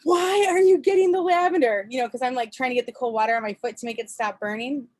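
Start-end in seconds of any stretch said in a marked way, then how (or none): 0.0-1.9 higher, louder annoyed demand; burned wife snapping at her husband; exasperated yell. Retold or imitated. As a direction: shout it back, baffled and mad. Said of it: "I was getting kinda mad".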